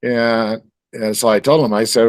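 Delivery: normal talking voice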